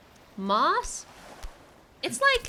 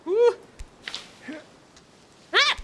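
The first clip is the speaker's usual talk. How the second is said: startled high yelp; scared horror game streamer; 4 semitones higher, 3 dB louder than usual. Real, not acted. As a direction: yelp then panicked nopes. Jumping across gap, startled.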